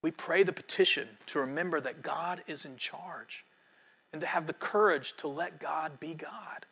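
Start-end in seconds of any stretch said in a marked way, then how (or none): 4.1-6.6 whisper. Real, not acted. Closing exhortation in sermon.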